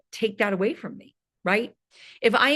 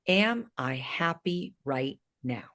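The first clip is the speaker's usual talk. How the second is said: softer, slow, asked inward; quiet reflective question; 5 semitones lower, 6 dB quieter, slower than usual. Shown as a demonstration. Fitness coach asking her viewers to reflect, soft and slow. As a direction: let the question sink in gently. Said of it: "I want you to ask yourself this question".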